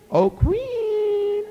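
high, loud sing-song call; drawn-out high hollering. Acted. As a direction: hail her from the yard. Jack calls to the queen.

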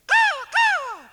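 very high, loud crow squawk; piercing falsetto caws. Acted. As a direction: screech it out like a crow. Crow's cry inside children's song.

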